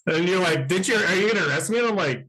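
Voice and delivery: a touch of a bemused cop; playful skit voices. Pretend. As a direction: act out the traffic stop in a lifted voice.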